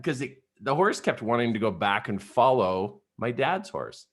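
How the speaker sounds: ordinary delivery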